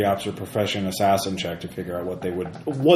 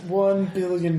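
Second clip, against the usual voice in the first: high mock upping; 8 semitones higher, slower than usual. Topping the joke.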